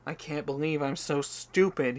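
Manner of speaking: lightly voiced grieving father; weeping, moaning. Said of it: "he moaned as he wept".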